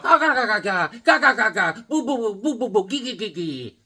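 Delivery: put-on Irish baby talk. Mocking the Irish boss's brogue as baby talk. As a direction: babble like a mocked Irish brogue. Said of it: "it's that Irish baby talk".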